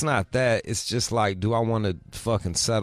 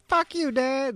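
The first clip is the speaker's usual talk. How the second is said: put-on much higher, bratty teen girl; mock teen daughter; slower than usual. Dad imitating his daughter snapping back, high mock bratty voice. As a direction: toss it off like a sulky teenager.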